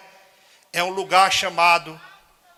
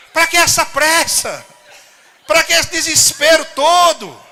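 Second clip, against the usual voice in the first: raised, louder, fervent preaching voice; 7 semitones higher, 5 dB louder than usual. Acted. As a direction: rouse the congregation with fervour. Preacher addresses the brothers. (pregação inflamada, mais alta e aguda)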